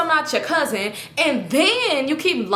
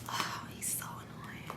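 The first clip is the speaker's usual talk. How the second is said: whispered complaint; 21 dB quieter, slower than usual. Retold or imitated. Complaining to Keisha while muted, whispering. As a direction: whisper it, slowly.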